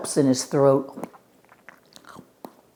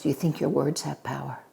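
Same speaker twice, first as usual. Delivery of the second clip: plain reading voice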